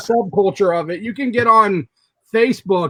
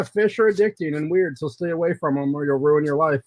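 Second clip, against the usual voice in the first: normal talking voice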